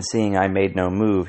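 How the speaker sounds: plain reading voice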